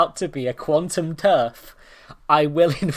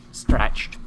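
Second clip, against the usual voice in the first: ordinary talk, nothing marked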